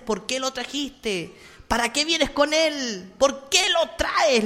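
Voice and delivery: high, drawn-out mock demonic cry; possessed girl screaming. Priest quotes the possessed girl shouting at the priest, high slow cry. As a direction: wail each question slowly, high and accusing. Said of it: "le gritaba".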